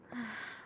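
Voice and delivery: soft relieved breath out; sigh of relief. Acted. Princess relieved the stranger stays, soft sigh. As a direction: let the tension go.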